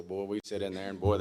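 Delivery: ordinary delivery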